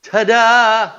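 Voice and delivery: a loud, high, bright reveal; spring the surprise on her; triumphant ta-da. Retold or imitated. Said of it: "And he goes".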